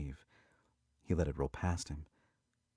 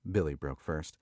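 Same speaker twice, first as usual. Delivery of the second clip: plain reading voice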